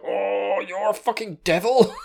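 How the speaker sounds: high-pitched imitated exclamation; mock astonishment breaking into a laugh; shocked. Bat demon shocked as chainsaw appears.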